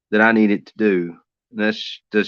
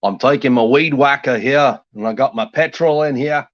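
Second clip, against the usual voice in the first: loud, higher-pitched hammed-up Australian accent; goofy Australian impression; 5 semitones higher, 5 dB louder than usual. Goofing off while weed eating, hammed-up Australian accent. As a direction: goof off as an Aussie, pitched up and loud. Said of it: "that's a somewhat decent one".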